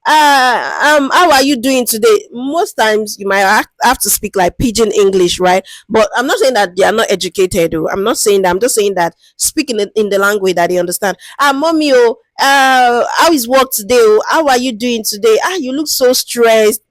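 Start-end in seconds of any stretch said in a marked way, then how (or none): 0.0-2.3 a chatty, friendly hello to an elder; lightly voiced friendly researcher. Shown as a demonstration. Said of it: "just start on a very light note with them". 11.4-16.8 a chatty, friendly hello to an elder; lightly voiced friendly researcher. Shown as a demonstration. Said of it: "just start on a very light note with them".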